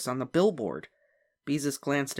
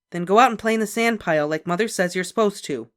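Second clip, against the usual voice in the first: firm order; 6 semitones higher, 6 dB louder than usual. A narrator's reading. Sister orders.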